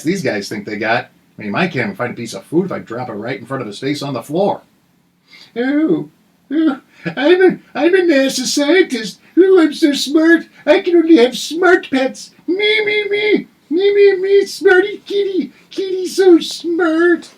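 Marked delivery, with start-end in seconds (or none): 5.5-17.3 high, hammed-up smug voice; mock them in a prissy tone; mocking snooty voice. Mocking NASA scientists.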